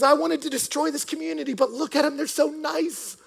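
high, helpless exasperation; imitating a ruler's plaintive whine; frustrated emperor. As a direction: complain in baffled defeat, stretching the end. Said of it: "he wrote to one of his men in frustration".

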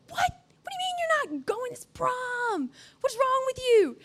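high incredulous friend voice; mocking outraged friend. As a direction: play the shocked teen friend. Friends outraged he skips prom.

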